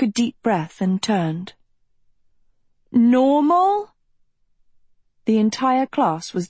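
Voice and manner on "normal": high, steeply rising question; hesitant questioning. A narrator's reading. Agatha asks her hiding class about what happened, uncertain question. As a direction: let it climb into doubt.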